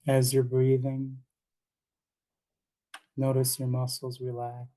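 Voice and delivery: unhurried meditation guidance with long pauses; calm guide giving breathing cues in short, spaced phrases; guided meditation. Acted. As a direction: lead them gently into relaxation, leaving space between phrases.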